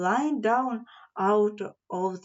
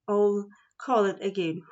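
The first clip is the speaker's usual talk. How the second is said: ordinary delivery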